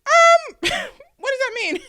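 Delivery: very high, loud mock bewilderment, breaking into a laugh; stunned disbelief. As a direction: squeak out the disbelief, then laugh. Retells her stunned reaction to diagnosis.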